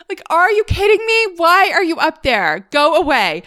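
high-pitched comic outrage; exasperated scolding. Retold or imitated. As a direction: act out telling off a pest, voice way up. Acting out shouting at the bat.

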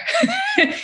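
short high laugh; quick bright laugh. Real, not acted. Laughs after punchline.

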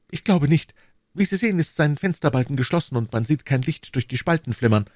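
high-pitched character voice for the husband; eager husband. A narrator's reading. (hohe Stimme des Ehemanns)

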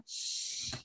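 "sh" as one long shushing sound; isolated letter sound. Shown as a demonstration. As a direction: hold "sh" on its own, shhh. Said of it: "You wanna say the sound".

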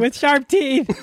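high-pitched squeal of alarm; frantic high add-on; retold panicked report. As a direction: cry it out high in mock panic. Retold telling friends in alarm.

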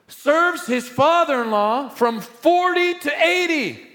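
high, loud preacher's cry; preaching at full force. Acted. Preacher crying out to the congregation, high and loud. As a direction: preach it out, climbing and ringing.

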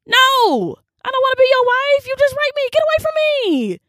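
Dinah refusing. Pretend. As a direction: push him away in Dinah's voice. Voices Dinah refusing Shechem.

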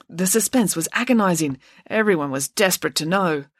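ordinary delivery, nothing marked

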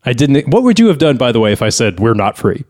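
normal talking voice

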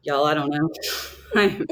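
put-on Southern accent with laughter breaking in. Nervous lawyer mimics her old Southern accent. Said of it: "I had this very deep Southern accent".

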